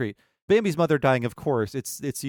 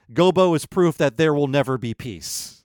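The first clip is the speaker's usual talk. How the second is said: ordinary talk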